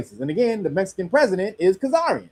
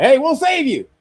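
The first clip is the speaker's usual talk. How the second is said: high, loud mock-friendly offer; mock savior; 8 semitones higher, 6 dB louder than usual. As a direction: sneer a fake rescue. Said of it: "America swoop in and be like".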